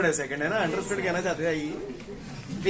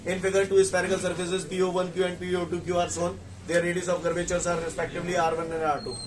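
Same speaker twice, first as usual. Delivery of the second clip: brisk recap read-through; quick reread; 3 semitones lower, 5 dB quieter, much slower than usual. Shown as a demonstration. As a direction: run through it again without pausing. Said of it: "मैं दोबारा बोल रहा हूं फटाफट".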